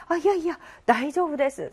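acted modest no-thank-you; polite refusal. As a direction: wave off the kind offer politely. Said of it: "사양합니다".

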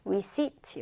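"receipt" said with no P sound; silent P. Shown as a demonstration. Teacher shows learners how receipt is pronounced, "receipt" with silent P. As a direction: say "receipt" as re-SEET, leaving the P silent. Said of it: "P는 발음하지 않는다라 그랬어요".

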